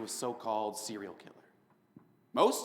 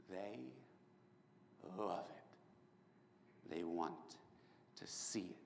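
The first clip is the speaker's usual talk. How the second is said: sinister whisper; 6 semitones lower, 16 dB quieter, much slower than usual. Acted.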